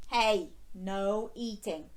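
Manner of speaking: usual speaking voice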